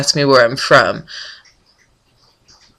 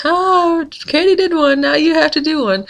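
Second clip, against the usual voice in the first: much higher, sing-song mock friend; teasing friend voice; 12 semitones higher than usual. Friend on Twitter needling her to vlog, high teasing mock voice. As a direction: tease her like a gloating friend, pitched way up. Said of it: "and she's like".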